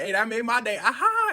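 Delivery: loud, high fake chuckle; forced laugh. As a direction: laugh it off awkwardly. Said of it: "Tries to play it off".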